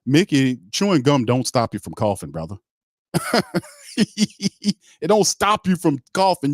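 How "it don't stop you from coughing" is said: slow, higher words through laughter; laughing, slowed and higher. Real, not acted. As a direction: laugh it out in disbelief. Laughing repeat.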